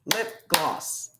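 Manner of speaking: "Lip gloss" said softly, one clap per syllable; clapped syllables. Shown as a demonstration. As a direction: tap out "Lip" and "gloss" for the class. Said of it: "Let's hear it again".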